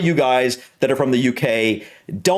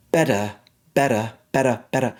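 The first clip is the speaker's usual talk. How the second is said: British "better", R dropped; British accent. Shown as a demonstration. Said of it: "in the British version".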